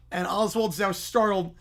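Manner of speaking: ordinary delivery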